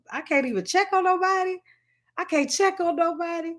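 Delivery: high-pitched retold protest to heaven; retold exasperated plea. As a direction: plead upward in mock dismay.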